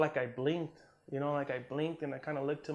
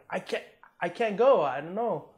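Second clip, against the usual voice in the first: high and loud, desperate; frantic, can't move; panicked; 6 semitones higher, 8 dB louder than usual. Retold or imitated.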